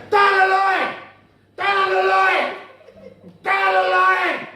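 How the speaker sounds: loud, high repeated yell; mock terrace shout. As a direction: bark it at the players. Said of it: "He was shouting".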